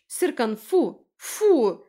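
high, loud scolding command; calling off a dog. A narrator's reading. Woman orders her dog off the injured man, loud. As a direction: shout the dog away, each word falling.

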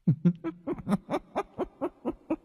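high shrill-free cackling demon laugh, then gone quiet; demon's cackle. Acted. Bared-fang demon laughing at the crowd, cackling. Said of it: "Nó cười khanh khách".

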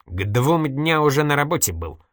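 lightly perky account; brisk, cheerful report. A narrator's reading. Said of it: "бодро отрапортовал журналист".

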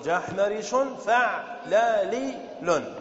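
جحمرش فعلالي لن spelled out slowly, each beat of فعلالي drawn out; slow syllable-by-syllable scansion. Shown as a demonstration. Teacher scanning جحمرش فعلالي لن for students, drawn out. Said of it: "فنقول".

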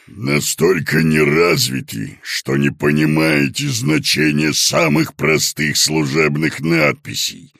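bang it out like a dented pot; clanging pot voice. A narrator's reading. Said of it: "оглушая Короткова кастрюльными звуками".

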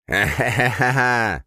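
slow gloating villain cackle; evil laugh. A narrator's reading. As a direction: laugh with menace, drawn out. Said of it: "злобно засмеялся Остроносый".

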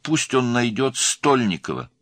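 high, loud, deliberate instruction; urgent phone-call order. A narrator's reading.

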